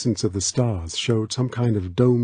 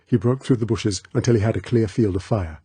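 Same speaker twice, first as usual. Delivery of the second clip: plain reading voice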